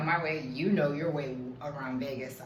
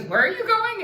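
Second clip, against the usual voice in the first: much higher and louder, a mock squeak of surprise; high-pitched question; 7 dB louder than usual. Driver asks her destination a second time, in a high, louder voice. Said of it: "And he goes".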